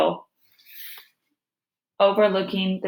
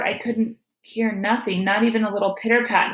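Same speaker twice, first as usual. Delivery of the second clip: usual speaking voice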